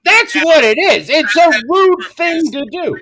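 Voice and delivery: high, loud exclamation; real worked-up insistence; emphatic agreement. Real, not acted. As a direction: pounce on the point, loud and high. Seizes on the word rude.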